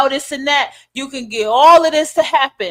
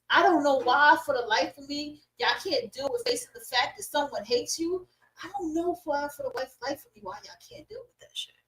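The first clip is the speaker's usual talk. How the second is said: softer and softer, nearly vanishing; exasperated muttering; 3 semitones higher, 11 dB quieter than usual. Real, not acted. Baffled that listeners can't face hate, exasperated muttering. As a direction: mutter it away under your breath. Exasperated repeats sinking to a mumble.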